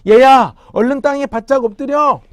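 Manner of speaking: put-on loud, high warning cry to a child; father shouting to son in alarm; urgent shout. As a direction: yell it to save him from danger. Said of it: "큰 소리로 외쳤어요".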